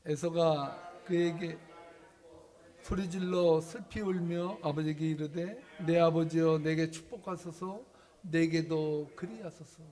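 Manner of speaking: slow, loud Bible reading; loud, slow pulpit reading of Esau's plea; scripture reading. Acted. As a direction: read it out loud, slowly, like a preacher. Reads scripture aloud.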